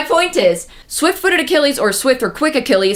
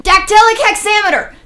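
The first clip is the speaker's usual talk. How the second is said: excited; 9 semitones higher, 6 dB louder than usual. Real, not acted. Excited blurt of the meter's name.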